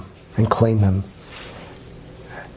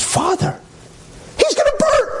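shrill pleading mother. Retold or imitated. Mother begging the priest not to let her son burn, very high cry. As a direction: wail it in desperation.

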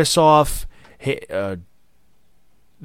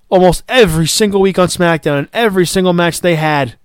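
usual speaking voice

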